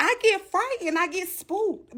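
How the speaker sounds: half-laughing as she admits it. Real, not acted. Laughs at her own story.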